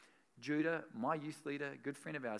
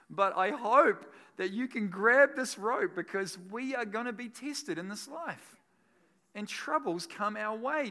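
loud, driving sermon voice, higher and louder; full-force preaching; 7 semitones higher, 9 dB louder than usual. Acted. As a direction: preach it out to the whole room. Preaching with rising intensity.

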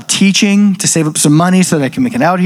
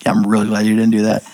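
deadpan; 7 semitones lower, faster than usual. Retold or imitated. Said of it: "straight face and goes".

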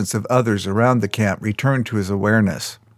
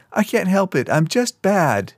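crying; 9 semitones higher than usual. A narrator's reading. Crystal crying to Keo in self-blame. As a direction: sob out the confession. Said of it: "Crystal cried".

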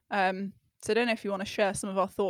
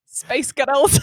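laughing, louder Scottish imitation; Glaswegian accent; 5 semitones higher, 9 dB louder than usual. Shown as a demonstration. Guessing the trick phrase with the co-host, Scottish accent. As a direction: have a go at Glaswegian through laughter. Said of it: "you sound like a Glaswegian".

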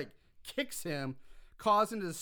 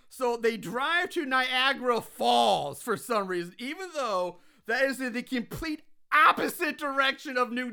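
loud, raised-pitch incredulity; exasperated rant; 6 semitones higher, 6 dB louder than usual. Real, not acted. Host rants to the co-host about the trip to Niagara Falls, exasperated. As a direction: hammer out how little sense it makes. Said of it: "I'm gonna go on a rant here".

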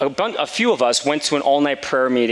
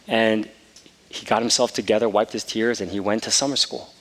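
usual speaking voice

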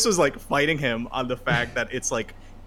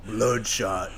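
trailer voice. Pretend. Movie trailer voice.

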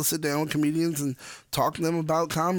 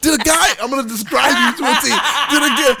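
higher, louder indignant rant; worked-up rant; 7 semitones higher, 6 dB louder than usual. Retold or imitated. [name] acting out confronting the store, loud laughing rant. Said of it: "he said".

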